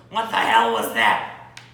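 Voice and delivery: ordinary talk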